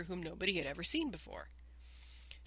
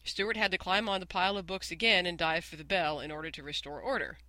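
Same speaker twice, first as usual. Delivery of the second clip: ordinary delivery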